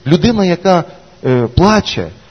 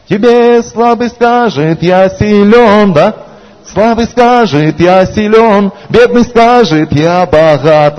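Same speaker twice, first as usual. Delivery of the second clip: very slow, raised, drawn-out preaching; slow declaiming; 4 semitones higher, much slower than usual. Acted. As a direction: stretch every word, proclaiming.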